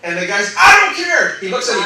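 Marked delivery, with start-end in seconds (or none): 0.6-1.4 high, loud brush-off; retold angry outburst. Trainer rejecting the teacher's defense, acted angry shout. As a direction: cut the objection off, imitating an angry man.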